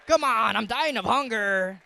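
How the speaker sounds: loud, pushy gangster Esau. Acted. Starving Esau pressing his brother for soup, loud pushy gangster voice. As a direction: lean on him like a street tough. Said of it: "He was like a gangster".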